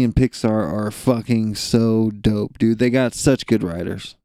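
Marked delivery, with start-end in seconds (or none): none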